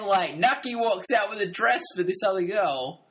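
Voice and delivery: normal talking voice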